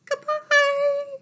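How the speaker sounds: goodbye squeaked up very high. Shown as a demonstration. Librarian sings the goodbye as high as she can for toddlers. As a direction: stretch "Goodbye." way up high and loud. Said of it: "say goodbye as high as we can".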